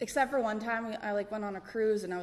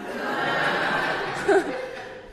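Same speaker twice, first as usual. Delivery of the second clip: a sudden high burst of real laughter; 8 semitones higher, 4 dB louder than usual. Real, not acted. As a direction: let the laugh break out, high. Laughs recalling first weed.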